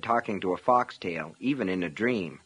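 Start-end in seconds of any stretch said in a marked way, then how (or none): none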